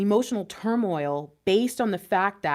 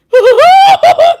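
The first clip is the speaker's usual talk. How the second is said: high, loud shriek of a laugh; 19 dB louder than usual. Real, not acted. Reader spooked by a card she drew, shrieking laugh. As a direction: burst into a startled screaming laugh.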